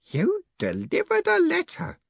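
put-on high, drawn-out awe of an old postman; breathless old Grote. A narrator's reading. Old postman questioning the mailman, awed. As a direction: say it slowly and high, in amazement. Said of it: "breathed Grote".

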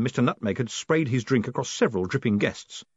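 ordinary delivery, nothing marked

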